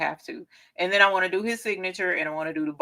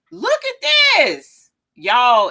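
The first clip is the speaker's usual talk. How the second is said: excited; 8 semitones higher, 7 dB louder, slower than usual. Real, not acted. Shows off the crimson.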